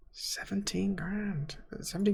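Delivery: soft, breathy astonishment; awed whisper. Real, not acted. Amazed at the stadium figure.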